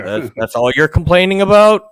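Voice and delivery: mock-exasperated old grouch; crotchety. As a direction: scoff at a petty complaint, voice rising. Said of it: "you sound like the crotchety old man".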